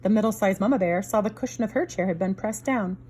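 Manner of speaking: usual speaking voice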